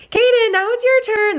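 high singsong call; high-pitched, retold coaxing; coaxing mom. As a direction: sing it out sweetly to a reluctant child. Mom coaxing scared son in story.

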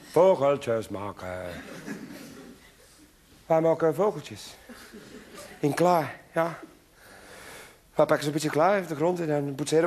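zacht, lager kinderstemmetje; stil kindje. Acted. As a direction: vertel het als een verlegen kind. Kind legt spel uit. (kindje vertelt zacht over het kleispel)

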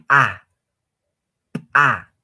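P said as a loud popping burst, "pa"; ejective. Shown as a demonstration. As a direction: pop the P of "pa" as if bursting something. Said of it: "como si estarían haciendo reventar". (pa eyectiva, como reventando)

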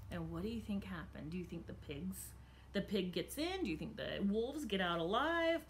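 usual speaking voice

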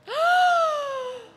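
high, loud held squeal; piercing mock-startled sound; sudden high cry. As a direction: let out a long high yelp. Performed cry before cowboy lines.

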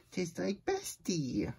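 ordinary delivery, nothing marked

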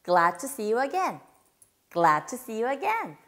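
slow, clear model line said three times; teacher models the line slowly for moms to repeat; slow repeat drill. Shown as a demonstration. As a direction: say it slowly for them to copy. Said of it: "세 번 바로 따라해주세요".

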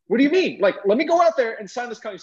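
put-on high-pitched, disbelieving teen pushback; indignant protest. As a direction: protest to dad, pitch jumping up. Teen argues with his dad.

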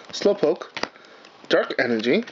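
ordinary delivery

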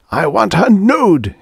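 slow, high, loud scream. Acted. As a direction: scream the demand. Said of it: "he screamed".

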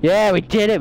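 high, loud triumphant whoop; victory cheer. Real, not acted. Gamer celebrating with team after winning, loud high cheer. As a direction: celebrate the win. Team collects all pages.

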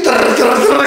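rolled trrr drum rattle, laughing; imitated kick drum. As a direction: buzz out the runaway kick-drum beat. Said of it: "que puso el beat".

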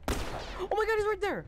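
high, panicked gamer shriek; panicking gamer. Real, not acted. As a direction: gasp it out in sudden panic. Spots enemy in horror game.

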